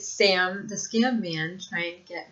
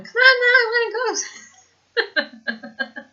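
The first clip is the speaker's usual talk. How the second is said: put-on high, loud, whiny little girl begging; impression of her daughter; 14 semitones higher, 6 dB louder, much slower than usual. Mother imitates her daughter begging to leave, high and whiny. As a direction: squeal it like an impatient kid tugging at mom. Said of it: "I'm doing my best impression of my daughter".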